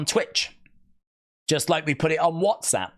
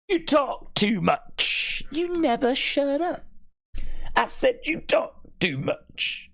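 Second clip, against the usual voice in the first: higher, slow, put-on scold; "You talk too much" in a much higher, slow put-on voice, wagging at himself; mock scolding voice; 13 semitones higher, slower than usual. A put-on voice scolding himself for talking, higher and slow.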